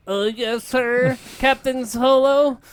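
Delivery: high, hesitant junior officer; nervous officer. Acted. Deck officer answers Han, high nervous reply.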